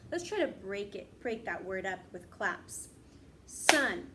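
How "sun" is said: clapped out. Shown as a demonstration. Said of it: "Let's try to clap out".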